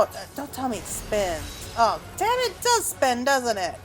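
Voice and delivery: ordinary talk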